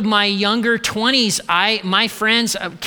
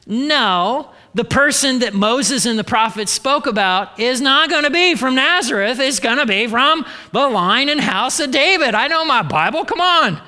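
higher-pitched, emphatic protest; insistent objection; 5 semitones higher than usual. Acted. Nathanael, devout follower, objecting to Philip, insistent protest. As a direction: argue it back, climbing to an exasperated finish. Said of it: "what he's saying to Philip is".

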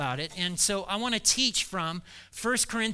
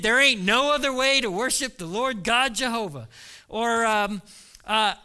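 normal talking voice

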